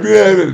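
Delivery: high-pitched imitated bawling; put-on wail of a crying child; mock crying kid. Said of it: "'Cause the kid's crying".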